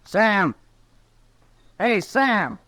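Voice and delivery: a high call across the room, rising at each name. Acted. Young drunk calling the barkeep over, a high call. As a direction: hail the barkeep from a distance.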